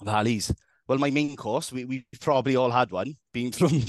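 put-on Valleys Welsh, lilting; amateur Welsh impression; Welsh accent. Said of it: "The Valleys".

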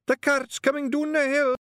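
high, loud Scottish call; lookout's cry. Acted. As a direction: shout the warning from the thicket. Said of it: "There was a cry".

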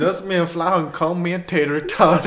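mock Southern twang, giggling; country western accent. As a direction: make up a hokey country saying. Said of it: "Can you do a country western accent?".

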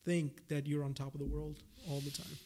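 usual speaking voice

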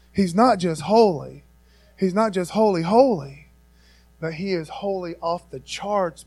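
usual speaking voice

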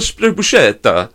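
put-on slow, showy Italian accent on the one word; mock Italian accent. Retelling how the buddy ordered, mock Italian accent. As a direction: imitate a buddy's affected Italian pronunciation. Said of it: "that's how he said it".

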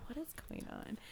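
whispered to oneself; hushed mock-anxious whisper; anxious self-talk. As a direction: whisper it as a private check on yourself.

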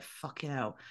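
a dropped, quiet aside of dismay; muttered under breath. Retold or imitated. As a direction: swear to yourself so nobody hears. Her reaction under her breath.

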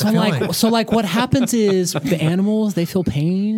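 high mock vegan, full of likes. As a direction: play a preachy vegan, lots of likes. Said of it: "you gotta say "like" at least fifteen times".

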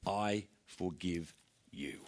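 slow, low and soft forgiveness; solemn forgiveness. Acted. Forgiving after years of dogged conflict, slow and quiet. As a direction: lay the words down slowly and quietly.

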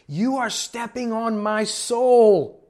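imitated woman's loud, high, drawn-out scream; hammed-up furious yell, stretched out; going off on him. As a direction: wail each word slowly at full outrage. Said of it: "going off on him".

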